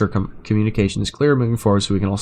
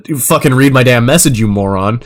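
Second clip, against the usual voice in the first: mock angry insult; 6 dB louder than usual. Acted quarrel, boss insults client.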